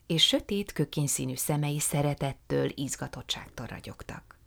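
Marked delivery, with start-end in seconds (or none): none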